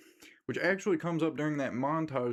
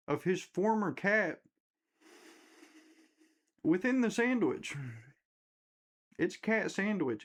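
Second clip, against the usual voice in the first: higher, rising disbelief at the twist; excited disbelief; 6 semitones higher than usual. Real, not acted. Telling friends the shocking twist, high and incredulous. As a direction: build up the horror as you tell it.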